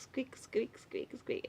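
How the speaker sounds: thin, whispery little squeaks; squeaky wheel imitation. Mom mimicking her daughter's noisy hamster wheel, whispered squeaks. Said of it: "it squeaks".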